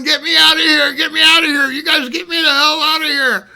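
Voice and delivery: high, loud imitation of a desperate man; frantic plea. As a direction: yell it urgently, over and over, pleading. Dave begging to be freed.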